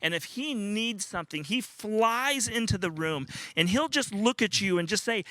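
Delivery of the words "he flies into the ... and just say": normal talking voice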